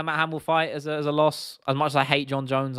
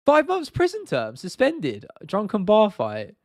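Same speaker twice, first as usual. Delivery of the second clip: high, slow stunned reading; reading out something unbelievable; shocked; 8 semitones higher, slower than usual. Real, not acted. Shocked reading news aloud.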